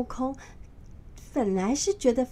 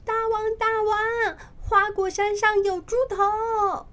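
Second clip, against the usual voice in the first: high, louder monkey calling his king; little monkey calling out; 11 semitones higher, 4 dB louder than usual. A narrator's reading. Little monkey bursting into the cave to alert the king. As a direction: squeak out an urgent call to the king.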